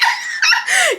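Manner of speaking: real high-pitched burst of laughter. Real, not acted. Vlogger laughing at Chuck's nerve, real high laugh. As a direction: laugh out at the memory.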